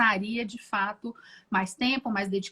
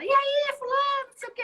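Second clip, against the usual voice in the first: expansive Brazilian greeting. Retold or imitated. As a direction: greet a buddy with big Brazilian warmth. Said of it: "A gente fala ... a gente abre os braços".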